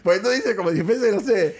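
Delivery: laughing while talking, slowly; chuckling through the words. Real, not acted. Teasing about the photographer friend.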